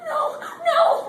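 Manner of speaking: sobbing shout; anguished cry. Acted. Wife realizing the crashed plane is his, screaming and crying.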